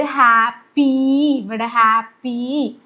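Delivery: ഹാപ്പി slowly, with the final ee clearly stretched; long ee demo. Shown as a demonstration. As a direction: draw out the ending of ഹാപ്പി. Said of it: "നീട്ടുന്ന ഇ ആണ് വരുന്നത്".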